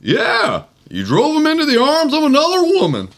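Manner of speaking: high, jittery nervous comic voice; Don Knotts impression. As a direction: play it twitchy and flustered. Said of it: "play Don Knotts".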